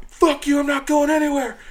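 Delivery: high, loud mimicked cursing; worked-up mock refusal; defiant yell. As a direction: imitate a man digging in. Defiant man refusing again.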